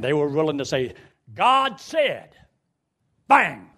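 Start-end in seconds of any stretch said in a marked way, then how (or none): 1.3-2.4 high, loud proclamation of God said; mock fiery preacher. Preacher recalls colonial pulpit, loud and high. 3.3-3.7 loud spoken bang with a laugh; mock gunshot. Preacher mimics musket firing, loud bang.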